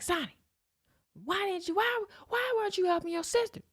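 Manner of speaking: high, mock stern mother's reproach; scolding mom.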